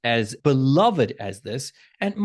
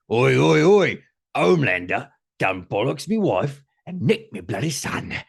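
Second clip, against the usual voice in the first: put-on Karl Urban's accent. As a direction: do a hammed-up Cockney accent. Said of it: "I wish I could pull off the accent".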